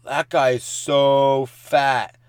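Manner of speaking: slow, drawn-out mocking kid voice. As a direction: stretch it out like a taunting kid. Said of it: "And they're like".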